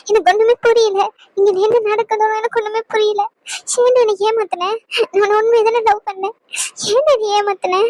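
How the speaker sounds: hurt, tearful questioning; crying. Acted. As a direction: ask through tears, breaking between phrases. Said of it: "அழுகாத, நீ அழுதா". (அழுதபடி கேட்கும் குரல்)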